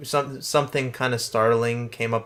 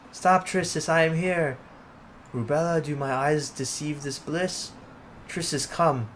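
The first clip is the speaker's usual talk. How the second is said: female character voice; 6 semitones higher than usual. Acted.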